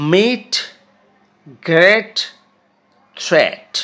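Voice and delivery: each word said loud and apart; teacher reading a list; word list drill. Shown as a demonstration. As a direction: read ডেট to থ্রেট as a drill. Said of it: "উচ্চারণগুলো খেয়াল করো".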